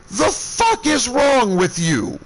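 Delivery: slow, high, deliberate fury; seething slowed-down yell; drawn-out angry demand. Retold or imitated. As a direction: drag out each word in disgust. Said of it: "I am so pissed".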